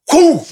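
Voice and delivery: high, loud impact noise; sudden punchy whoof; imitated kick whoosh. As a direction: voice the kick landing.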